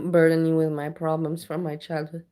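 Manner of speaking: usual speaking voice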